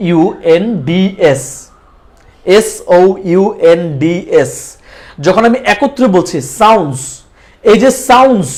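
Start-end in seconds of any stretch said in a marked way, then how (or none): 0.1-1.4 slow, separated letter names; spelled letter by letter. Shown as a demonstration. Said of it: "আলাদা আলাদাভাবে spelling করেছি". 2.6-4.5 measured spelling, each letter distinct; name every letter separately; spelled letter by letter. Shown as a demonstration. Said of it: "আলাদা আলাদাভাবে spelling করেছি".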